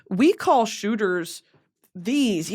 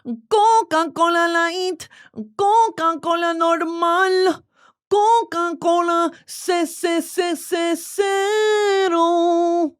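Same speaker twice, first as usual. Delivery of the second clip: slow, high, drawn-out product read; mock announcer; 9 semitones higher, 3 dB louder, much slower than usual. Reading Coke label flavors like an ad announcer, slow and high. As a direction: sell each flavor like an announcer.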